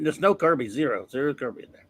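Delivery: ordinary talk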